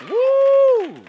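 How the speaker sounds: high, ringing whoop; a sudden high praise holler; preacher's whoop. Real, not acted. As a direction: let out a celebrating whoop to the church. Preacher's whoop mid-sermon.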